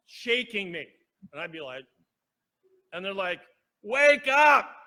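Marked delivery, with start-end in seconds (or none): 3.8-4.7 loud, high call to wake up; yelling nurse. Retold or imitated. Nurse yelling at drowsy patient to wake up, loud and high. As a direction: shout it at a patient who won't wake. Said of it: "She was yelling at me".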